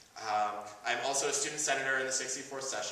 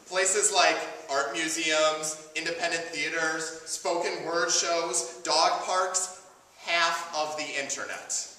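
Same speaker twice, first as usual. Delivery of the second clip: emphatic, projected spoken-word delivery; prepared monologue; 5 semitones higher, 7 dB louder, slower than usual. Acted. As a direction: deliver it as a measured speech to a crowd.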